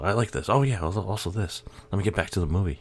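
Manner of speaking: mocking my own commentary. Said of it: "that's how my commentary's all".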